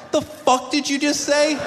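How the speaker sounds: high, slow disbelief; shocked viewer. Real, not acted. Viewer yelling at TV host, high stunned disbelief. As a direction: gape at the TV.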